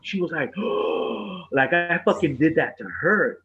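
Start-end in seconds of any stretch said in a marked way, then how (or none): 0.6-1.5 mock sharp intake of breath; shocked gasp. Imitating the daughter shocked at being scratched back, gasping. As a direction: gasp like an outraged child. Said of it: "she was shocked".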